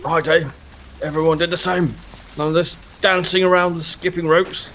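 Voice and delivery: put-on high, stiff old soldier; a proud veteran sizing up a recruit; old army man voice. Mr Muntz voice.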